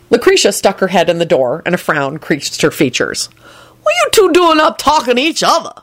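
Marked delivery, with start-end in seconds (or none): 3.8-5.7 high-pitched suspicious woman. A narrator's reading. Lucretia catching two people up talking, suspicious. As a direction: demand it in a high voice. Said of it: "she said suspiciously".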